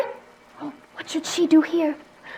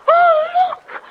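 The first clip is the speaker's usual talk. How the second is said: high, loud cry of terror; a sudden shriek of fright; terrified cry; 4 dB louder than usual. Acted. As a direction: cry out at the ghost. Juliet sees Tybalt's ghost.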